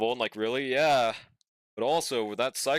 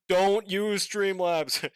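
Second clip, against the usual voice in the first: emphatic warning; 5 semitones higher, much slower than usual. Real, not acted. Chat asks about streaming software.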